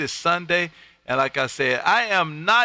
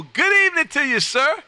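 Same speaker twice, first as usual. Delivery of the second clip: much higher and louder hearty hello; excited greeting; 11 semitones higher, 4 dB louder than usual. Real, not acted. Greeting a new radio contact.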